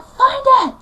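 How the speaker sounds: very high, loud yelled command; furious. Retold or imitated. Mum ordering daughter to find remote, furious. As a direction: yell the order sharply. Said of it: "getting so furious".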